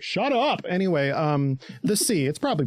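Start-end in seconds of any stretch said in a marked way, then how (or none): 0.0-0.6 high, curt mock brush-off; dismissive snap. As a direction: bark it out dismissively. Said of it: "Erwin is just like".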